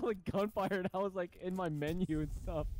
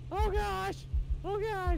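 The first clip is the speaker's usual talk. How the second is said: excited exclaiming; 11 semitones higher, 4 dB louder than usual. Real, not acted. Under fire, high exclaims.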